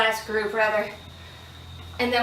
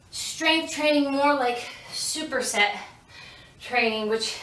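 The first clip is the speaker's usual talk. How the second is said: ordinary talk, nothing marked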